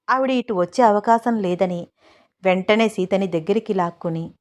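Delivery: usual speaking voice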